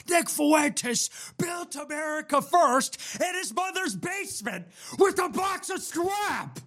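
high far-off shouted movie quote; movie villain voice. Pretend. Iron Man parody line.

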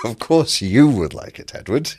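mock-accusing tease with a grin; teasing. Real, not acted. Teasing the data-loving host.

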